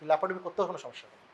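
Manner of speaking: ordinary talk, nothing marked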